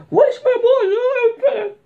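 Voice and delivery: very high, loud mock crying; fake-cry like a sulking child; whiny kid voice. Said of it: "De mode". (pleurnichement d'enfant très aigu)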